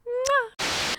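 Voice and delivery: loud high smacking air kiss. Acted. Host thanking the sponsor, high loud air kiss. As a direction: blow the sponsor a big kiss.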